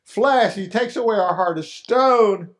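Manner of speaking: normal talking voice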